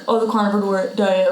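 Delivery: ordinary talk, nothing marked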